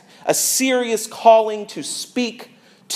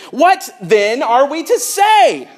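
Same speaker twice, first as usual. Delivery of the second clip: high, slow exasperated cry; exasperated yell; 7 semitones higher, 3 dB louder, slower than usual. Shown as a demonstration. Paul fed up with the church, yelling the question. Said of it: "But I think he's yelling this question".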